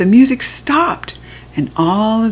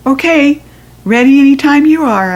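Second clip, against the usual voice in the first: louder, higher eager call; eager yell; 6 semitones higher, 6 dB louder than usual. A narrator's reading. Girl yelling to the cake lady, louder and higher. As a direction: shout that you're ready. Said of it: "I yelled".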